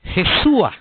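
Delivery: "Jesúa" set apart, pitch lifted, landing on a clear A; "Jesúa" pronounced with the A ending to show how it sounds; Jesúa with final A. Shown as a demonstration. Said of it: "No se oiga".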